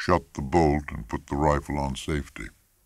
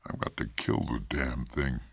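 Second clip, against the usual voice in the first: subdued, softer dejection; miserable; 3 semitones lower, 6 dB quieter than usual. A narrator's reading. Husband dreading the lion he must kill, mildly miserable. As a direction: say it quietly, with understated misery. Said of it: "Macomber said miserably".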